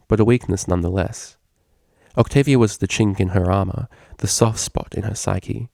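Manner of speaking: ordinary delivery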